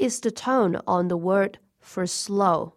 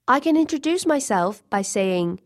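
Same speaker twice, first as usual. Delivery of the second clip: normal talking voice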